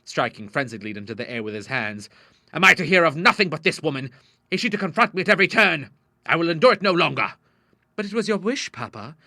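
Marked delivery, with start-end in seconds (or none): 2.5-7.3 loud, higher indignant protest; angry outburst. A narrator's reading. Father rants about the neighbour, loudly indignant. 8.0-9.2 put-on high girlish objection, falling away; protesting daughter. A narrator's reading. Daughter reminds father of his wish, meekly protesting.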